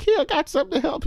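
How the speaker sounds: put-on sweet girl voice. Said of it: "She's like".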